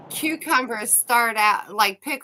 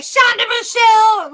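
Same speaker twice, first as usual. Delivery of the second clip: high, loud scolding call; mama calling out; 11 semitones higher, 7 dB louder than usual. Retold or imitated. Mama calling her daughter by full name growing up, loud holler. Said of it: "When my mama would be like".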